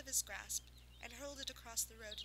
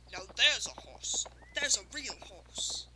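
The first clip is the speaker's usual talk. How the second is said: loud, slow, admiring cry; excited exclamation; 2 semitones lower, 10 dB louder, slower than usual. Acted. Villagers exclaiming at the running unicorn, loud. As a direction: marvel out loud at a fine horse. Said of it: "those who saw her exclaimed".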